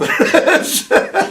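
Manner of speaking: breathy laugh. Real, not acted. Quizmaster laughs during question.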